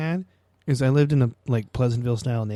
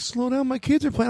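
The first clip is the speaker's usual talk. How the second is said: higher, whiny parent voice; mock fussy parent; 11 semitones higher than usual. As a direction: poke fun at a fussy parent. Mocking overprotective parents.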